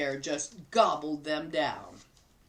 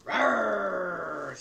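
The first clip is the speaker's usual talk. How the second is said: bear growl. Acted. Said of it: "said the bear".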